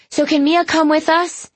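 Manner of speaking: put-on high-pitched child, pressing to get an answer; creeping impatience. A narrator's reading. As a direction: ask in a high, impatient little-girl voice. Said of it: "this time the impatience creeping into her voice".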